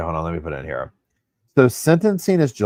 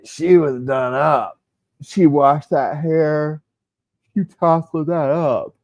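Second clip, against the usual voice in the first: slow, higher, sassy drawl, stretching each word; 6 semitones higher, 5 dB louder, slower than usual. Retold or imitated. Gossiping about Lori's hair to viewers, slow sassy drawl. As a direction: savour the gossip, lay it on slowly.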